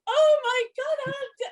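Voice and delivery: very high-pitched wail, tailing off; panicked fluster. Retold or imitated. Woman stuck in the revolving door with a star, panicking. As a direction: re-enact star-struck panic, voice pitched right up.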